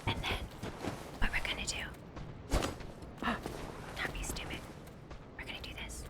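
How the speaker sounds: hushed whisper to herself. Real, not acted. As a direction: whisper the plan under breath.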